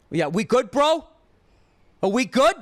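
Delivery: high, loud mock bro challenge; tough-guy challenge. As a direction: puff up like a bro picking a fight. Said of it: "he looks at me and he goes".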